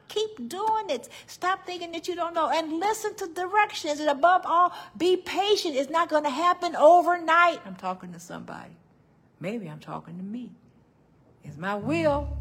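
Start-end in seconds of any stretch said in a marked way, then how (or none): 0.1-7.6 high, loud, fast voice of God urging her on. Acted. God telling the preacher to be a musician, high and fast. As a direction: speak as God, lifted and eager. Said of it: "He said".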